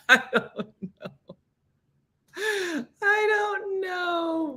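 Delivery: slow, high-pitched laughing wail; helpless laughing. Real, not acted. Cracking up over cranky cat voices, helpless laughing. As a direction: squeal it out through laughter, drawn out.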